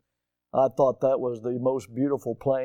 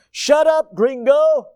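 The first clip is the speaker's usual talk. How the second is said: high, loud irritated shout; imitating an exasperated pilot yelling; snapped; 11 semitones higher, 8 dB louder, slower than usual. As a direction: snap it back at the warning system.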